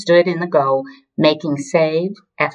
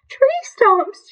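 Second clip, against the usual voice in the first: very high tearful wail; 3 dB louder than usual. A narrator's reading. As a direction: wail it, hammed-up and offended. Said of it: "she wailed".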